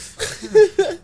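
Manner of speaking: real high laugh. Real, not acted. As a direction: laugh hard at your own joke. Laugh after punchline.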